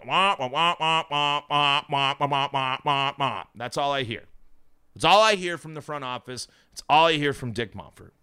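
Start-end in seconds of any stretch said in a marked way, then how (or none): 0.1-3.4 Charlie Brown adult voice imitation; trombone-like wah wah nonsense; muffled wah wah talk. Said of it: "Charlie Brown".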